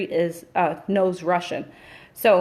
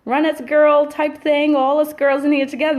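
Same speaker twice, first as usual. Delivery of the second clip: mock upbeat cheer, a little higher and louder; playing it bright for the group; forced cheerful; 7 semitones higher, 5 dB louder than usual. On a call, forcing a cheerful team voice. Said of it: "trying to smile and say".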